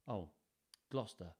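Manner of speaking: ordinary delivery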